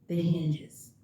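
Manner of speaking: normal talking voice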